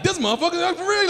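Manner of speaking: high-pitched mock disbelief; retold disbelief. Comic reacting to man who peed, disbelief. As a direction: voice rising in acted incredulity.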